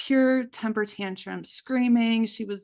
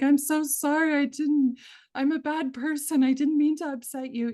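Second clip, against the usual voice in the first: put-on higher, pleading little girl; crying child's apology; 7 semitones higher, 3 dB louder than usual. Young daughter apologizing to mom, higher and pleading. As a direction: plead like a sorry small child. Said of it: "She's like".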